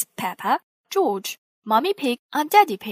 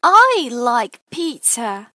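contented; 9 dB louder, slower than usual. A narrator's reading. Peppa enjoying pizza.

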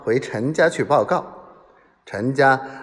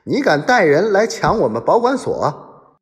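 higher, louder angry rebuke; indignant narrated scolding, pitched up; 6 semitones higher, 4 dB louder than usual. A narrator's reading. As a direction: bawl him out indignantly. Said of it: "一面斥喝".

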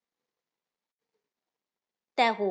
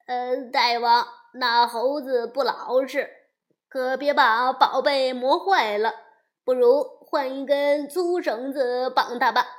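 loud, eager minion reporting to his king; a loud flunkey voice; disguised demon servant; 8 dB louder than usual. A narrator's reading. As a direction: bellow it up like a fawning servant.

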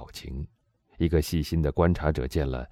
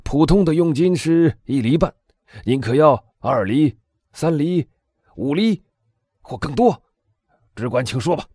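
high, loud offering of commission; eager bargaining; 11 semitones higher, 6 dB louder than usual. A narrator's reading. Boville urging the Englishman to name his fee, excited. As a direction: warden keen to please the buyer. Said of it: "大声说道".

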